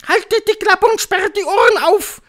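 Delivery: very high, loud witch scolding; snapping at them. A narrator's reading. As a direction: screech at the crowd to obey. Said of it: "fuhr sie die Hoch". (anfahrende Hexe, schrill und laut)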